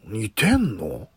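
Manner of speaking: a lightly voiced Matsuko, slow and unhurried; just a hint of Matsuko's voice, leaning in a little; Matsuko impression. Shown as a demonstration. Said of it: "ちょっと寄せてみた". (軽くマツコ・デラックスに寄せた声)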